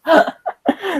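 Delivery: short high burst of laughter; real giggle; laughing. Real, not acted. Laughing at own joke.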